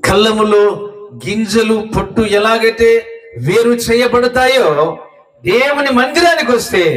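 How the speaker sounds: slow, high, drawn-out sermon phrases; impassioned preaching. Acted. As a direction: stretch the words, climbing in pitch. Preaches with rising pitch. (సాగదీస్తూ హెచ్చు స్వరంలో ప్రసంగం)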